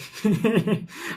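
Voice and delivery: high, giddy chuckle; happy laugh. Real, not acted. Coder delighted that it works, happy laugh. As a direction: laugh out of real happiness. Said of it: "I'm so happy".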